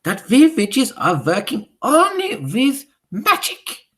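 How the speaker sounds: very slow, high, loud witch shout; Grand High Witch shouting at the audience. Acted.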